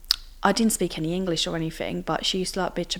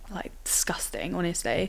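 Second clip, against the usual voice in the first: whispered disgust; 10 dB quieter than usual. Real, not acted. Describing being spat at.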